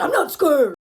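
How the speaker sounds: loud, blustering mock denial; mock angry denial. Man denying fear to girlfriend, mock angry bluster. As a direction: bark it out as his defensive comeback. Acted denial in retold argument.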